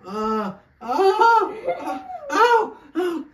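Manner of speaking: sharp rising cries of pain. Real, not acted. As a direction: yelp as the cramp hits. Period pain simulator reaction.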